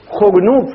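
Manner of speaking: خرنوب with the kha pronounced clearly; correct pronunciation. Shown as a demonstration. Teacher showing students the correct form of خرنوب, clear and deliberate. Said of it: "خرنوب بالخاء".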